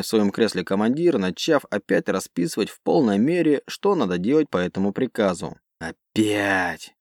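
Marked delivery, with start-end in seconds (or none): 5.8-6.9 low annoyed growl. Acted. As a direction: growl it in irritation. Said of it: "прорычал первый пилот".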